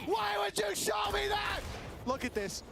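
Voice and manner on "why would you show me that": high, slow appalled shout; disgusted laughter. Real, not acted. Replay shows brutal whiff.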